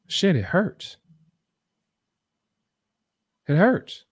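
high-pitched reenactment of crying in pain; retold tearful hurt. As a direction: act out hurting through tears. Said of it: "I start crying".

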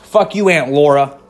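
half-hammed teenage tantrum at mom and aunt; imitating a defiant kid yelling back; angry kid.

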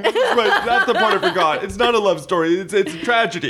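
high, laughing realization. Real, not acted. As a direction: laugh out the words.

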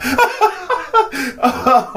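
big high burst of laughter; loud laugh. Real, not acted. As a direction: really crack up.

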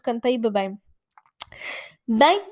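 ordinary talk, nothing marked

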